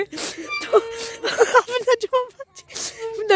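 high squeal of laughter mid-word; cracking up while naming her; high-pitched laughing cry. Real, not acted. Laughing so hard it hurts.

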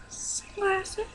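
high, soft singsong reveal; airy high-pitched unveiling; playful reveal. Pretend. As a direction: lift it high and light like a little ta-da. Reveal after mock fanfare.

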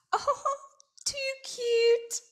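very high rattly lizard voice; rattling Lizard. A narrator's reading. Lizard teasing Quokka, high rattle. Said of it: "rattled Lizard".

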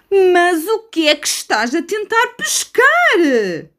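high slow puzzled bird voice; seagull voice. Acted. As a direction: ask it like a baffled gull. Gull asks Osman.

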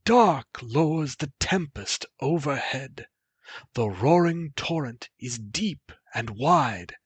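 put-on old man warning. The old man describing the storm to the youth, pleading. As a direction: paint the danger to stop him. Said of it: "the old man said".

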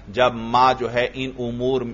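ordinary talk, nothing marked

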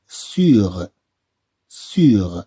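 sounded out clearly for learners; slow model word. Shown as a demonstration. Teacher modelling a French word for pupils, slow and clear. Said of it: "إذا نقول سيغ".

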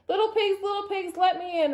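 high-pitched storybook roar. A narrator's reading. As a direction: roar at the door as the wolf, playfully. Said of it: "roared the wolf".

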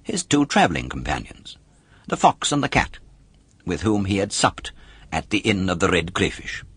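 ordinary delivery

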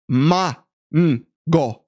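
"Ma-, mm, go" sound by sound with pauses; Spanish, broken into sounds. Shown as a demonstration. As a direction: spell out "Ma-, mm, go" with an open ah. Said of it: "with a Spanish accent".

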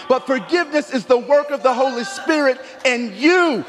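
loud, high preaching climax. Acted. Preacher pressing the church, loud and rising. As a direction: drive it home to the congregation.

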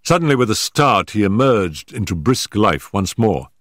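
plain reading voice

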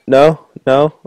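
ordinary delivery

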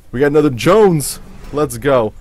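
yelling with excitement; hushed then yelling excitement. Real, not acted. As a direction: cheer the lucky pull. Loud cheer at pulled card.

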